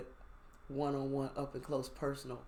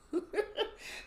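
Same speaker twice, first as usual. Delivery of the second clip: high airless laugh. Real, not acted. Laughs at fate.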